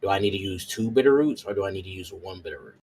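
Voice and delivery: normal talking voice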